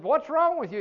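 high-pitched, incredulous demand; mock exasperation. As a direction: demand an answer, voice jumping up. Retold exasperated question to crying man.

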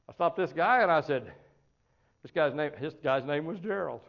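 ordinary delivery

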